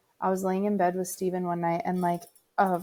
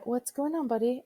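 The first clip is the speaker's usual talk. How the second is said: gentle mom; 6 semitones higher than usual. Retold or imitated. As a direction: lift the voice kindly to a little boy. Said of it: "And I go".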